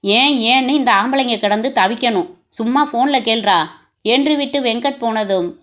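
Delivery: usual speaking voice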